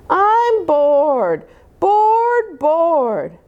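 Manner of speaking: whiny bored. Acted. Little girl whining to her mom, high and bored. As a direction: drag out each bored like a sulky kid.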